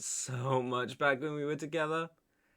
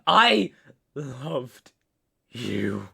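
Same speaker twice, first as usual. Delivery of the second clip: anguished declaration; 3 semitones lower, 6 dB louder, much slower than usual. Acted. Betrayed man confessing his love, anguished declaration. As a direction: burst out on the single word.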